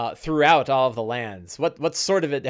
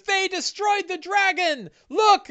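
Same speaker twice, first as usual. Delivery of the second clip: much higher mayor proclaiming to the whole town; triumphant shout. Acted. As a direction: announce the victory to the crowd. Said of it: "And he shouts out".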